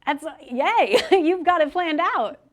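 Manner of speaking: loud, laughing yay; delighted burst of praise; excited cheer. Real, not acted. Host cheers finalist's plans.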